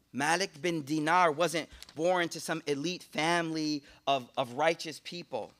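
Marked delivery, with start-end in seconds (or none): none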